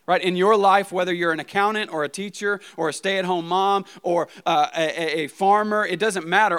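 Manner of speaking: rally the congregation to their calling; building sermon climax. Acted. Pastor urging the congregation, preaching loud and high.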